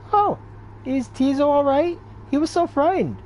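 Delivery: a high, worried companion's cry. Acted. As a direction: fret over the little imp in a high voice. Worried companion voiced in game.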